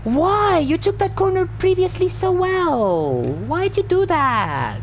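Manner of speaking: high, exasperated whine; whiny plea. Real, not acted. Driver scolding himself over a corner, high whine. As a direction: plead up high in disbelief. Pleading with himself after a mistake.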